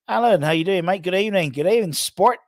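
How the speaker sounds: mock Australian accent. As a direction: greet him like a jokey Aussie mate, badly done Australian. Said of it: "I sounded American doing an Australian accent".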